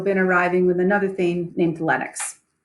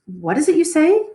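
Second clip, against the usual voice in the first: surprised; 9 semitones higher, 5 dB louder than usual. Acted. Macbeth feigning shock.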